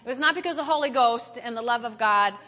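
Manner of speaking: ordinary talk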